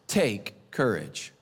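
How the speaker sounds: a calm word of courage. Retold or imitated. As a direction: say it gently and deliberately. Quoting Jesus to the crowd.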